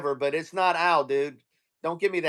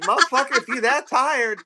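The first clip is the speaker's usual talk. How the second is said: high, indignant telling-off; acted exasperation; 5 semitones higher than usual. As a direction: rant at someone yawning in your ear. Acted rant at yawning agent.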